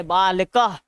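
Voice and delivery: बालकः ending in a breath of h; visarga pronunciation. Shown as a demonstration. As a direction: say बालकः with an audible h at the end. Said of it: "ह के समान".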